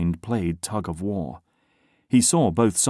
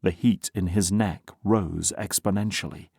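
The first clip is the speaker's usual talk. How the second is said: plain reading voice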